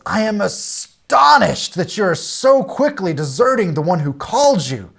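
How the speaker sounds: lightly voiced astonished reproach; astonished Paul. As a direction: read it like a stunned, disappointed apostle. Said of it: "is astonished".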